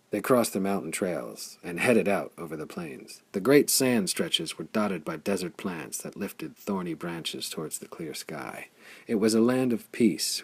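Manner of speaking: ordinary delivery, nothing marked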